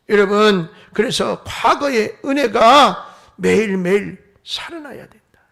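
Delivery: loud, insistent sermon delivery; forceful preaching. Real, not acted. Preacher warning the congregation about lost dreams, forceful. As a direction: drive each point home to the congregation.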